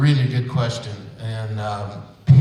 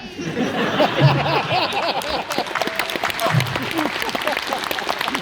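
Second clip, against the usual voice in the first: long, much higher croaking creature sounds; froggy pond noises. Pretend. Voice actors make pond animal noises for the audience. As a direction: croak and burble like a pond full of frogs. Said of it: "our impression of a pond in the forest".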